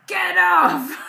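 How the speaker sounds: high, loud, gruff little shout; gruff toddler shout. Retold or imitated. Toddler ordering someone away, gruff shout. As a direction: bark it like a tiny boss. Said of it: "in this really gruff voice".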